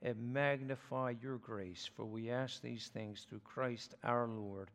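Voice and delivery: low, even praying voice; hushed close on a low amen; prayer. Real, not acted. Opening prayer before the sermon.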